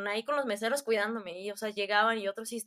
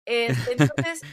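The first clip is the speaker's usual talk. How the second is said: drawn out; 9 dB louder, much slower than usual. Real, not acted. Drawn-out filler amid laughter.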